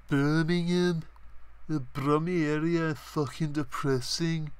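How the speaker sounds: high, drawn-out mock Brummie accent. Vlogger mocking Birmingham as depressing, in a Brummie accent. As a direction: put on a high-pitched Brummie voice. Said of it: "Brummie accent".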